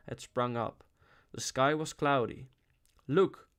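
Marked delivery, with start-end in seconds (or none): 3.1-3.5 a sharp, loud command; one clipped command word. A narrator's reading. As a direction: order her to look. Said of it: "he commanded".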